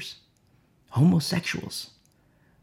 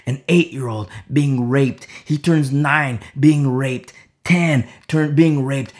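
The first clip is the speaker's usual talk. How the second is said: ordinary delivery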